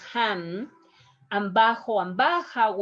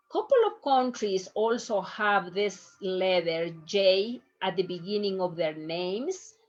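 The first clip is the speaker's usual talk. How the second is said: ordinary talk, nothing marked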